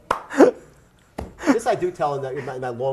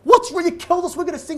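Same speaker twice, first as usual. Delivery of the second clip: freaking out; 14 semitones higher, 4 dB louder than usual. Retold or imitated. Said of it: "she's freaking out".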